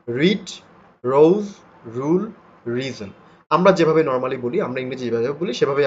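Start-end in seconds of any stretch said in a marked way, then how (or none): 0.1-3.1 রিড, রোজ, রুল, রিজন with a clearly sounded r at the start; clear initial r. Shown as a demonstration. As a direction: model each word, sounding the r that opens it. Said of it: "আমি আবারও বলছি শুনেন". (শুরুর র স্পষ্ট করে উচ্চারণ)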